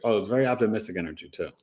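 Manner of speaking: usual speaking voice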